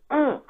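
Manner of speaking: high-pitched comic "mm"; Dave Chappelle mock hum. Player relishing the river card he needed, comic high hum. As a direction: squeeze out a high, gloating little hum. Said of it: "like fucking Dave Chappelle".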